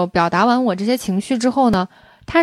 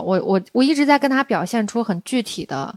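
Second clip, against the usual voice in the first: ordinary talk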